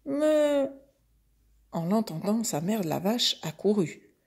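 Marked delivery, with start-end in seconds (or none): 0.0-0.7 high wailing calf moo; mock crying. A narrator's reading. Calf starting to cry after being counted, high mock wail. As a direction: stretch it out as a whimpering calf. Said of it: "se mit aussitôt à pleurer".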